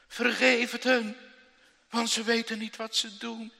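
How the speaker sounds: loud, high plea for forgiveness; calling up to heaven, loud and high; loud cry. Acted. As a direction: cry it out to the Father. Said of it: "Ja, dat roept hij".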